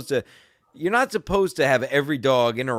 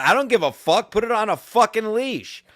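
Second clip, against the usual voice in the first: raised, forceful comeback; angry retort; 8 semitones higher, 4 dB louder than usual. Retold or imitated. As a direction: re-enact snapping back at the owner. Retold shouting match.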